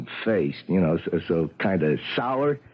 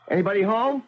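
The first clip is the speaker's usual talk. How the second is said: calling out; 11 semitones higher than usual. Acted. Doorman calling into apartment.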